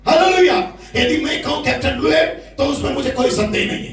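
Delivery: rousing call-and-response sermon; fiery preaching. Real, not acted. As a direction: drive the questions home to the church. Preaching at full force.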